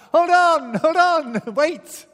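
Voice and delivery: high loud call from the dark. Retold or imitated. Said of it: "a voice from the darkness calls out".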